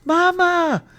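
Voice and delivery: high, loud call across a distance; hollering shout. A narrator's reading. Boy calls his mama up the hillside, shouting. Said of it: "I shouted".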